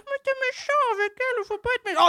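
high, fast pleading voice; pleading ovary voice. Acted. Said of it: "j'ai fait parler mes ovaires".

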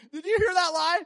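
high, loud laughing call; can't stop laughing at the lie; amused God calling out over a lie. Pretend. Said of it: "What does God do when he hears a lie".

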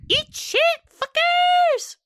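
high hag insult; Hagatha voice. Acted. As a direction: spit it out as a shrieking hag. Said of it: "she basically goes".

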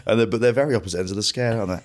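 usual speaking voice

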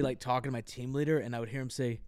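ordinary delivery

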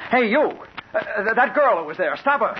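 high, loud call across to someone; urgent yell. Acted. Reporter yells to a window washer to stop the girl, urgent yell. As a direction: yell out to get them to stop her. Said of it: "I yelled at him".

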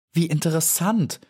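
delighted, high-pitched interest; lifted, eager wonder; happy exclamation. A narrator's reading. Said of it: "rief die kleine Maya glücklich".

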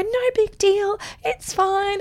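high, singsong reassurance; calming mum. Retold or imitated. Mum calming her crying son, reassuring. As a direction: soothe a devastated toddler, pitched right up. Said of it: "I had to calm him down".

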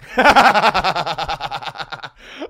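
loud howling laugh. Real, not acted. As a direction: crack up at the absurd booking. Cracks up at bad lineup.

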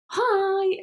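put-on high, simple child's hi; imitating toddler's normal hi. Mum imitates daughter greeting normally, high child voice. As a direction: say hi lightly, up high. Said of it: "which is normal".

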